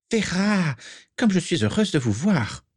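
high, wistful woman's voice; tinged with sadness. A narrator's reading. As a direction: greet a friend with sorrow underneath. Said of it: "dit celle-ci d'une voix empreinte de tristesse".

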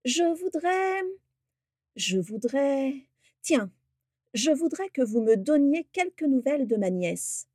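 drawn-out, high, mock-pondering request; ominous smile. A narrator's reading. Orion asking after his niece, slyly drawn out. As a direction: toy with them, stretching the opening words. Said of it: "avec un sourire qui n'augurait rien de bon".

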